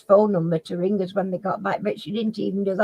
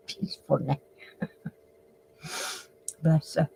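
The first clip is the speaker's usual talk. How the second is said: ordinary talk, nothing marked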